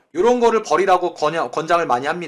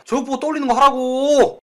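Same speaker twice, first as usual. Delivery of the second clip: insistent call; 6 semitones higher, 3 dB louder than usual. Real, not acted. Teacher insists to students.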